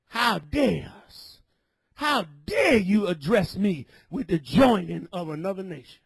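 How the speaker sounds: normal talking voice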